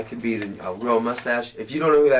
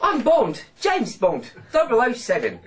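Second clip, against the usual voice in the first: high, loud, hammed-up English James Bond; badly faked English spy introduction; bad English accent; 8 dB louder, slower than usual. Shown as a demonstration. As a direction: put on a failed English accent, pitched way up. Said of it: "every time I do an English accent".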